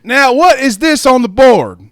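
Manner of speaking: loud, high, drawn-out teacher demand; stern teacher hollering at the class; Steve Harvey. Acted. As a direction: put on an exasperated classroom teacher, slow and booming. Voicing teacher Steve Harvey in a bit.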